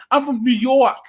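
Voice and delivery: high, loud mock New York accent. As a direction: imitate a woman's thick New York accent. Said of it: "women who have that New York accent".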